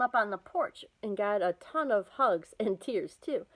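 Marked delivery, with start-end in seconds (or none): none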